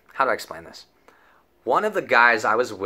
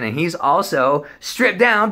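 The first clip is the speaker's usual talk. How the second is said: ordinary talk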